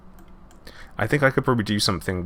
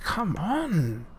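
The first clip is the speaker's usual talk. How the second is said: mock exasperation; 10 semitones higher, 3 dB quieter than usual. Gamer exasperated at demanding guests.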